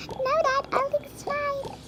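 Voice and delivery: lightly voiced daughter; child to Dad. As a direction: kid reassuring her dad. Child character answers Dad.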